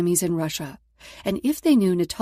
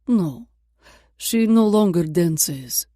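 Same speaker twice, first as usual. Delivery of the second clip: put-on curt Russian accent; 5 dB louder, slower than usual. Dancer replies in Russian accent.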